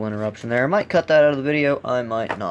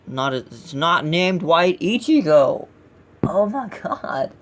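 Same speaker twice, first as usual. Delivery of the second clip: loud, high, drawn-out exasperation; raised-voice fan outrage, stretched out; exasperated disbelief; 5 semitones higher, 4 dB louder, slower than usual. Real, not acted. Outraged at a character's name.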